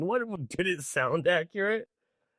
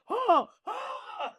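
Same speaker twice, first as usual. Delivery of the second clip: a sudden high scream, cut short; mock death scream. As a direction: scream like a man caught by the shark. Acting out Quint's shark death.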